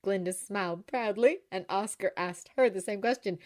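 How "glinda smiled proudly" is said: ordinary delivery, nothing marked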